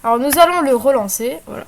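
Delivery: usual speaking voice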